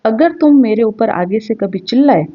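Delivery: ordinary delivery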